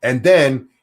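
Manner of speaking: ordinary delivery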